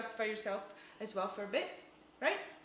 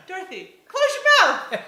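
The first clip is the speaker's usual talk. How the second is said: loud teasing call; 8 semitones higher, 15 dB louder than usual. Retold or imitated. Husband in the car teasing his dazed wife, loud. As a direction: bark it playfully, laughing.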